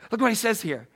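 normal talking voice

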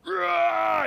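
loud, high weightlifter's growl; gym growl. Retold or imitated. Guy grunting under heavy weights in the gym, loud growl. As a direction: let out a gym roar like lifting heavy. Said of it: "the growl like that".

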